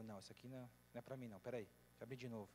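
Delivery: low, soft flustered murmur; a believer rejecting the verse he opened; flustered mutter. Retold or imitated. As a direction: mutter it nervously to yourself.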